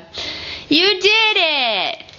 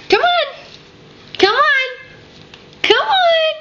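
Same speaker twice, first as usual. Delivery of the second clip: excited cheering; 9 semitones higher than usual. Real, not acted. Mother cheering baby's first steps.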